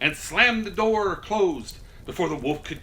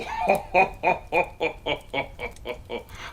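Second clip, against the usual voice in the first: amused laugh. Real, not acted. Laughing after the ending.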